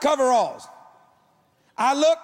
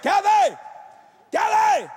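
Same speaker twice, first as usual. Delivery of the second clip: calling out; 8 semitones higher, slower than usual. Retold or imitated. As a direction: call her name up through the floor. Calling wife from under house.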